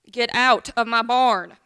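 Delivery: loud, grumpy order; grumpy mule. Acted. Creepy mule orders the friendly old lady out, grumpy and loud. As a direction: push each word out like a cross mule. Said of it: "Why are you so grumpy".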